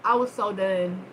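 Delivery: ordinary talk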